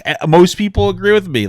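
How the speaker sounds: high, loud, offhand shrug of a reply; shrugging dismissive retort. Retold or imitated. Streamer mimicking his own reply to critics, breezy and dismissive. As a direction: toss it off like it's obvious. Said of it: "I'm like".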